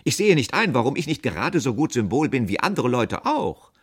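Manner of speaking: loud, high indignant protest; affronted outburst; offended shouting. A narrator's reading. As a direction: protest loudly, deeply insulted. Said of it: "schrie ich beleidigt".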